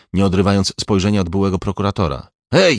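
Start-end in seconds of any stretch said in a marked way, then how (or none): 2.5-2.8 short raised call; shout. A narrator's reading. Young policeman calling to his colleagues, shouting. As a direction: call out to alert the others. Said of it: "krzyknął".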